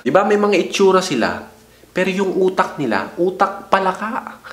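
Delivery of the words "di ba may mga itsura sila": ordinary delivery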